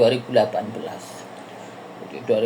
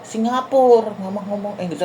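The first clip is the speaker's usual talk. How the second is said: higher, mock exasperated child; the daughter correcting her mother, pitched up; child scolding mum; 6 semitones higher than usual. (menirukan anak yang kesal, nada tinggi)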